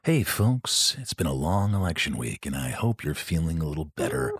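soft radio voice. Pretend. Host soothing listeners after election week, soft radio voice. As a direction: lull listeners gently, unhurried. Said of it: "my soft voice".

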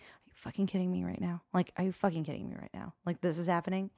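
a soft mock groan of disbelief; hushed disbelief. Inner panic, quiet.

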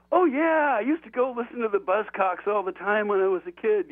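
high-pitched, cheerful reminiscing; jovial border official. Retold or imitated. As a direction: imitate a guard chatting fondly about bands. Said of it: "it was all very jovial".

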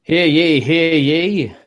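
loud, formal court crier call; stern archaic proclamation. Pretend. Judge opens the mock courtroom.

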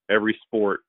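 ordinary delivery

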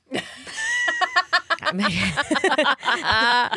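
long burst of helpless laughter; big laugh. Real, not acted. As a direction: laugh hard at being teased. Teased about being swung around.

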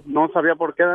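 ordinary delivery

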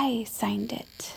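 a sneaky little whispered admission; hushed guilty aside; whispered confession. Acted. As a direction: whisper it, slow and secret. Confessing forged permission slip.

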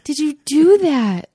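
dad lecturing his daughter in the car, stern; parent scolding. Retold or imitated. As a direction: scold like a dad giving the talk. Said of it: "giving her the whole parent talk".

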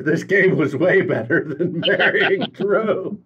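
reading through laughter; laughing. Real, not acted. Host reading Ruby's jab at Drew, laughing. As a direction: break into a laugh partway through. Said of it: "Sorry, I'm laughing".

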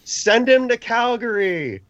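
high, loud, drawn-out fan's holler; imitating a fan yelling at a player; retold heckling yell. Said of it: "He's yelling at Dustin Wolf".

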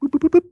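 high, mock electronic boops; rising beeps. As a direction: imitate a price ticking up. Price going back up.

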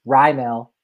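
Raimel in two full syllables, RYE-MELL; guide pronunciation. Shown as a demonstration. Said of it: "It's capital R Y E".

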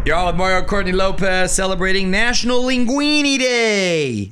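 loud, high radio announcement; announcer flourish. Real, not acted. Host opening the segment on National Linguini Day, announcer flourish.

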